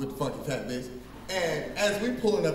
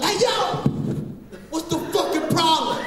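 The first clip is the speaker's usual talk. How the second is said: high, loud challenge; mock gangster yell; 10 semitones higher, 3 dB louder than usual. Gang member confronting the comic, mock yell. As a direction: bark it at him across the lot. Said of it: "he was like".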